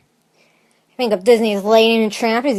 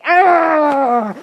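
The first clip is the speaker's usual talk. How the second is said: mock cringing cry; 4 semitones higher than usual. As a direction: imitate bad singing with a pained holler. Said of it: "Before it was like".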